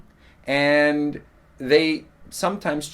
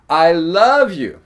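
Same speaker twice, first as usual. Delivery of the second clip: "love" stretched high and loud; slow, loud swell on "love", then falling; stress on love; 5 semitones higher, 9 dB louder, slower than usual. Shown as a demonstration. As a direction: draw out and punch the word "love". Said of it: "there's different ways to intone".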